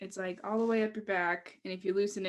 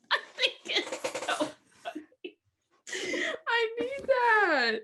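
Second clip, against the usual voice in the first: high, drawn-out squeal of laughter; laughing; 13 semitones higher, much slower than usual. Real, not acted. Delighted by her sticker idea, laughing. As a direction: barely get the words out laughing.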